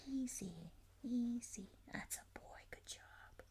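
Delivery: calm and praise him in a whisper; soft soothing whisper. Real, not acted. Owner soothes and praises the dog in a whisper.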